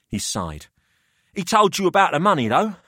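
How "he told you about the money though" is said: put-on higher, smug older host; a wry businessman weighing up the boys; Pelham's voice.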